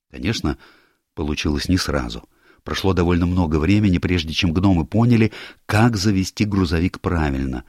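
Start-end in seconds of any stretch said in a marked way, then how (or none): none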